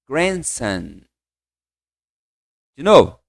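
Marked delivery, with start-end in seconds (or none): 0.1-1.0 Grandson said slowly and softly as grend-sun; pronunciation demo. Shown as a demonstration. Teacher models Grandson softly for class to repeat. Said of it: "neto, ó, comigo".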